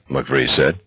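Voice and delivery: usual speaking voice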